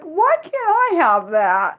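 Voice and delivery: high, drawn-out mock whine; envious whine. Jealous of a relative's British accent, envious whine.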